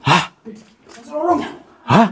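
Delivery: ordinary talk, nothing marked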